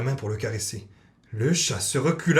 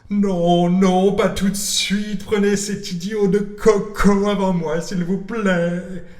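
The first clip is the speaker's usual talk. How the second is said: high, slow begging cat voice; pleading cat; 7 semitones higher, 4 dB louder, much slower than usual. Acted. Frightened cat begging to go after the parrot. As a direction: beg to be spared like a scared cat.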